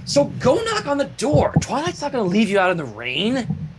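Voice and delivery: lightly voiced brash pony giving orders; mild bossy pony. Rainbow Dash telling the fillies to knock, lightly voiced and bossy. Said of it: "Rainbow scolds the fillies".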